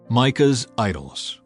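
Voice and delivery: ordinary delivery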